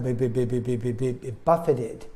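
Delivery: low, rapid repeated beep syllables; imitated battering noise. Shown as a demonstration. Teacher imitating wind buffeting the house, low repeated beats. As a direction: make repeated low hitting sounds.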